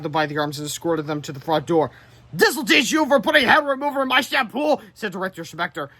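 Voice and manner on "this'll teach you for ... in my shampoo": much higher, louder, spiteful; gloating. Acted. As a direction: crow it with petty triumph. Director gloating at boys.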